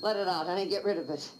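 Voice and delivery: comforting a terrified friend in her arms; soothing comfort. Acted. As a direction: hush her like a crying child. Comforting a sobbing woman.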